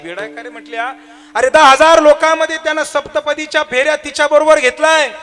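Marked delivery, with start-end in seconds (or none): 1.4-5.1 high, loud impassioned preaching; preaching at full force. Acted. Preacher answers the varkari's question, loud and high. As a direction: hammer the point home to the crowd.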